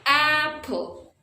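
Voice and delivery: APPLE with a high, long AP, falling away; stress on first syllable. Shown as a demonstration. Teacher demonstrating word stress, AP punched in apple. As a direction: lean hard on the AP of apple. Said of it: "is the first syllable".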